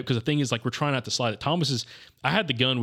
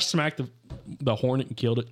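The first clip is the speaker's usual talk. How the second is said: ordinary talk, nothing marked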